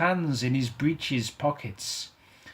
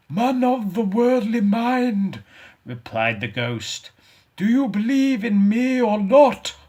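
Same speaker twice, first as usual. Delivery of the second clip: high, loud booming ghost; 10 semitones higher, 7 dB louder than usual. Acted. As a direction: demand an answer from beyond. Ghost challenging Good.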